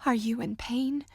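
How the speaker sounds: usual speaking voice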